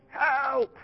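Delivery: high, thin squeak of "Help", half-joking; mock cry for help. Preacher to children, imitating the Israelites crying "Help" in a high squeal. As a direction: squeal "Help" like a kid in trouble. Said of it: "are they saying".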